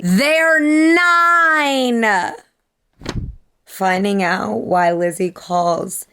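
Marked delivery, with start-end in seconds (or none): 0.0-2.4 loud, high, drawn-out cry; anguished wail. Real, not acted. Reader distraught at the children's age, loud wail. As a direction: wail it out, stretching the last word. Wailing at the characters' young age.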